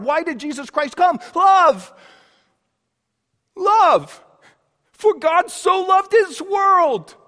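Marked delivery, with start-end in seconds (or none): 1.4-4.2 ringing answer. Real, not acted. Answering why Jesus came. 4.9-7.1 proclaimed scripture. Real, not acted. Same verse proclaimed again.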